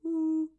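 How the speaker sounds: curious ooh. Shown as a demonstration. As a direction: marvel at a toy. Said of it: "playing with your shouty finder first".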